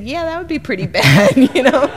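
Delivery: laughing loud and high; can't hold back the laugh. Real, not acted. Answering her son in the checkout line, laughing loudly.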